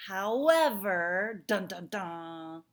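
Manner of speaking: loud, drawn-out suspense sting; mock-dramatic reveal. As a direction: stretch it out like a playful drumroll announcement. Mock dramatic reveal before exception.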